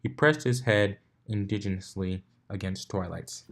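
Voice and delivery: ordinary delivery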